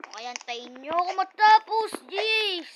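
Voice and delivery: loud, exasperated groan of impatience; frustrated outburst. Real, not acted. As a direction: blurt it out, fed up. Impatient outburst at slow game.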